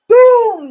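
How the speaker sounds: sudden loud, high "boom"; booming impact noise. Retold or imitated. Describing a mace knocking a man out, explosive boom. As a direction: burst it out like a heavy blow.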